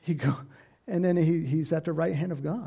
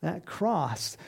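ordinary delivery

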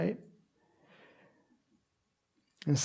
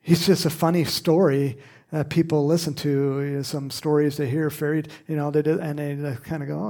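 ordinary talk

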